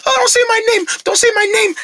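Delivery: high squeaky mock whine of the pig; mocking imitation. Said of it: "you go like".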